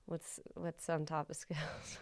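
whispered aside to herself; whispering. Real, not acted. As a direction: drop to a whisper while searching for the word. Searching for a word aloud.

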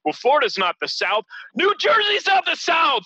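mock the know-it-alls, rising to a yell; parroting the critics in a hammed-up high shout; mocking quote. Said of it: "I've been hearing".

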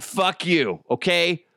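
ordinary talk

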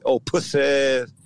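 put-on slow, drawn-out Florida accent on "pussy ass". Mimicking Florida boys, slow Florida drawl. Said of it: "am I saying in my Florida voice".

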